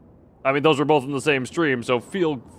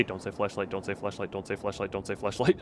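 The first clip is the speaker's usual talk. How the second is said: low, soft, quick monotone chant; mental mantra; 6 semitones lower, 9 dB quieter than usual. Retold or imitated. Streamer repeating the thought they have at every flashlight, muttered mental mantra. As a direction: repeat it under your breath like a private reminder. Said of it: "I do have to mentally say that".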